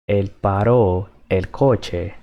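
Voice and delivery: Él paró el coche said slowly, every word clear; slow teaching repeat. Shown as a demonstration.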